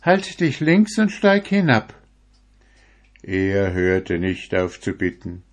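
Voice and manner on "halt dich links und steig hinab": put-on high, firm old woman voice. A narrator's reading. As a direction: send him off in a high old voice. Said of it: "war die Antwort".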